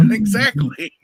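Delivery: high, loud, through laughter; agreeing through a loud laugh; laughing agreement. Real, not acted. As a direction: laugh out the word.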